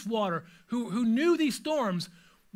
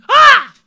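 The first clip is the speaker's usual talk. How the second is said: sudden high loud shriek; startled shriek; panicked shriek; 21 dB louder than usual. Shown as a demonstration. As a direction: shriek as if nearly losing control. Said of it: "they let out a shriek".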